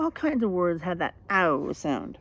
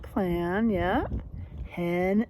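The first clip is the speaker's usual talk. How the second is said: ordinary delivery, nothing marked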